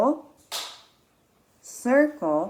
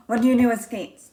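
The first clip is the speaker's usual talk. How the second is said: ordinary talk, nothing marked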